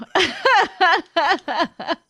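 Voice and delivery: loud high laugh; laughing hard. Real, not acted. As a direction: burst out laughing. Laughing at chat question.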